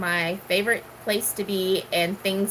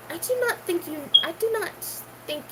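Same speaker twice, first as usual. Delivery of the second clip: higher, hammed-up movie swordsman line; movie quote impression; 9 semitones higher, 3 dB quieter, faster than usual. Quoting The Princess Bride to her viewers, higher put-on voice.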